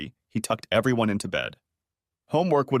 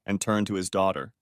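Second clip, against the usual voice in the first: usual speaking voice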